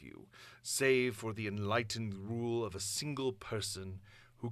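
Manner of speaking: a hint of a proud ruler revealing himself; Doom on his big moment. Shown as a demonstration. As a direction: play the tyrant's purpose, not the voice. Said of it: "focus on not the voice, but what he's actually trying to do".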